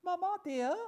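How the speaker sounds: put-on very high small-child voice; lisping little girl. Little Jane answering her mamma, lisping child. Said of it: "lisped the little girl".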